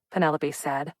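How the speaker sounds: ordinary delivery, nothing marked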